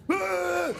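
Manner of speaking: very high, short mock scream; mock soldier scream. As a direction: let out a sudden yelp of pain. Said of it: "that real conversation was like".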